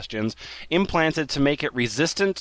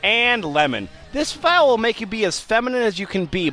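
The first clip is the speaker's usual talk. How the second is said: ordinary talk, nothing marked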